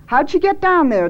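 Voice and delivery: high, insistent question; demanding. A narrator's reading. Janice questions Thad about Mittens in the well, demanding. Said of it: "demanded Janice".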